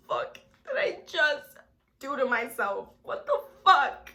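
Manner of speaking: horrified self-reproach. Retold or imitated. Girl regretting coming to the house, horrified. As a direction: berate yourself in disbelief.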